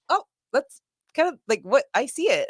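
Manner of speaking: high, startled "oh" that settles into recognition; dawning realization. Retold or imitated. Watching Serena Deeb, realizing she likes technical wrestling. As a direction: catch yourself noticing something new. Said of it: "I'm like".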